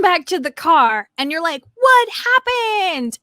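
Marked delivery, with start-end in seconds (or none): 1.8-3.2 very high, drawn-out demand; mock-excited, pitched way up; imitated eager question. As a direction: stretch it out like a friend dying to know. Friend's eager question, retold.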